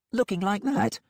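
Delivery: doubtful, uneasy question; Molly voice. A narrator's reading. Molly sceptical.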